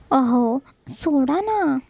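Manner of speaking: very slow, coy plea; bashful slow let go of me; shy drawn-out plea. Acted. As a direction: stretch it out, half protesting. Shy wife pleading to be released.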